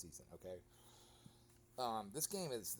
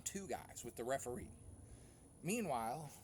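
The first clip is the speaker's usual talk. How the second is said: ordinary talk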